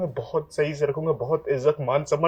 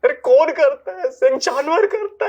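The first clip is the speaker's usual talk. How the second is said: anguished outburst; 7 semitones higher, 3 dB louder than usual. Acted. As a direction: cry out in disgust at yourself. Anguished self-condemnation. (ऊँची आवाज़ में खुद पर गुस्सा)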